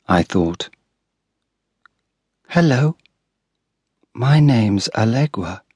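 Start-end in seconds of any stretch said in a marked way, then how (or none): 2.5-5.6 put-on lightly raised small girl's voice; a hint of a soft little girl; soft sweet little girl. A narrator's reading. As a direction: gently voice a sweet five-year-old. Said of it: "Her voice was soft and sweet".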